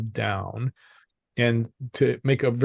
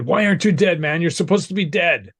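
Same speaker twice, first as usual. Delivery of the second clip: higher, louder, incredulous demand; imitating someone freaking out, pitched up; retold freak-out; 7 semitones higher, 4 dB louder than usual. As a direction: act out a freaked-out man's disbelief. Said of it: "and he freaked out".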